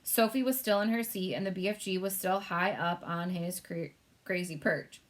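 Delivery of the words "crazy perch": ordinary delivery, nothing marked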